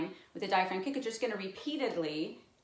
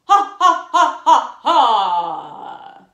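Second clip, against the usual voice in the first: loud hammed-up villain cackle; evil laugh; 5 semitones higher, 19 dB louder, much slower than usual. Shown as a demonstration. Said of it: "I'm gonna go for the evil laugh".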